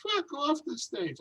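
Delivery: imitating the woman yelling from the back, high-pitched; heckler's yell. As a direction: screech it like the heckler again. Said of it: "still yelling the same epithet".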